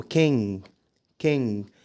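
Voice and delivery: "king" ending on the nasal, no hard g; silent final g. Shown as a demonstration. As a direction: keep the g of "king" unsounded. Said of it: "وفي كلمات تانية برضو زي كلمة".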